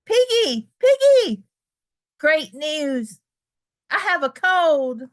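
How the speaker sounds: high, loud, delighted thanks; overjoyed storybook elephant; joyful relief. Acted. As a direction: burst with happy relief. Gerald runs to tell Piggie.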